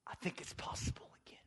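quick, soft whisper; hushed whisper. Real, not acted. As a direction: whisper it like a fragile hope. Preacher drops to a hushed aside.

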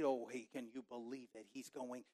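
soft disapproving crowd mutter. Pretend. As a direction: gossip under your breath about Jesus. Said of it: "That's part of the muttering".

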